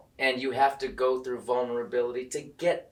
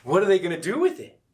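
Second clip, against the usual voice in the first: excited; 7 semitones higher, 7 dB louder than usual. Retold or imitated. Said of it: "the audience is like".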